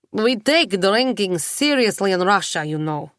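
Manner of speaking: half-voiced Russian lilt, leaning into the vowels; Russian accent. A narrator's reading. Mishka boasting to Nicola about drinking, light Russian accent. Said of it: "His accent becomes more pronounced".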